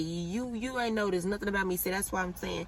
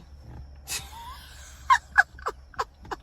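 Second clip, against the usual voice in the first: airless wheezing laugh, much higher; breathless laugh. Real, not acted. Remembering his deadly look, wheezing silent laugh.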